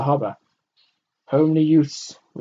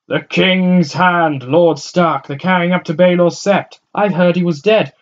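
excited crowd voice; 8 semitones higher, 11 dB louder than usual. A narrator's reading.

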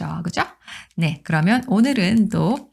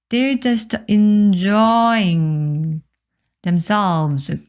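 enjoying drawn out very slowly; slow careful teacher reading; slow model reading; 2 semitones higher, much slower than usual. Shown as a demonstration. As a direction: read it slowly for the class. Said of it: "slow model reading".

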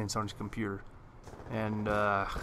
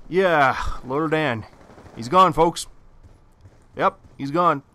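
loud announcement; 6 semitones higher, 10 dB louder than usual. Real, not acted. Telling viewers his coworker quit, loud and emphatic. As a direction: belt it out like big news.

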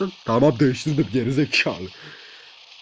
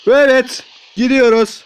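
higher, louder shout; loud rallying cry; 7 semitones higher, 6 dB louder, slower than usual. Real, not acted. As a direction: call out the charge.

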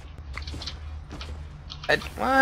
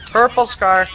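louder, excited call; 5 semitones higher, 6 dB louder, slower than usual. Real, not acted. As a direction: shout it across to your teammate. Calling out loot louder.

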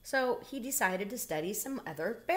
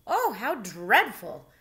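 louder, slow horrified gasp of words; dismayed cry; 7 dB louder, slower than usual. A narrator's reading. Bear reading about stolen porridge, slow dismayed cry. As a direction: clutch your chest in storybook dismay. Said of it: "Bernard cried".

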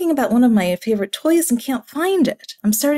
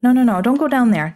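ordinary talk, nothing marked

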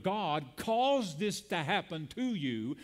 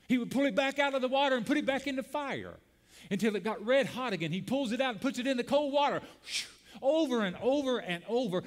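higher and faster, rushing on; building excitement; 7 semitones higher, faster than usual. Real, not acted. Preacher building the tempering story, fast and rising. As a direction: pile the steps up with rising urgency.

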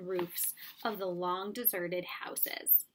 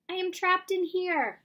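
high, loud cry from inside; 9 semitones higher, 6 dB louder than usual. Acted. Trapped boy calls to his friends, high and loud. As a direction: call out to be rescued. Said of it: "Mowgli cried".

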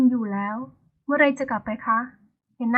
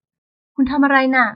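slow, raised voice in sudden alarm; loud alarmed question; 6 dB louder, much slower than usual. Acted. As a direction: call out in shock at her rushing in. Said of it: "ถามเสียงดัง". (ถามเสียงดังอย่างตกใจ)

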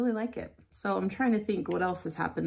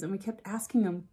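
normal talking voice

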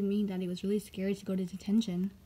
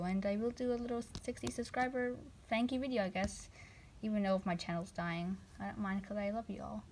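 ordinary delivery

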